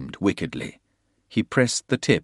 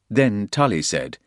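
usual speaking voice

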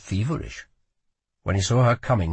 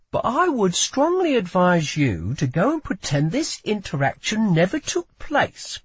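higher-pitched, emphatic advice; pointed warning; 8 semitones higher than usual. A narrator's reading. Lila advises guard to let her pass, pointed warning. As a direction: warn him off with strained patience.

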